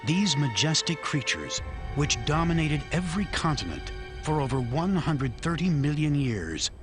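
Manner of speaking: grave, measured documentary narration; nature documentary. A narrator's reading. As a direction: narrate it like a wildlife film. Documentary narrator over music.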